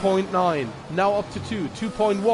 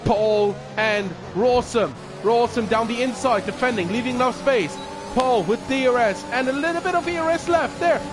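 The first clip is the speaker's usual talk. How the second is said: motorsport commentator; 4 semitones higher than usual. Real, not acted. Live race commentary, building.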